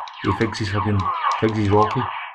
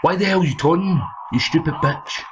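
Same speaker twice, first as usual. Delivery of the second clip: angry yell; 7 semitones higher than usual. Real, not acted. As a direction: shout him down for taunting. Yelling at taunting game opponent.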